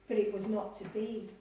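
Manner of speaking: usual speaking voice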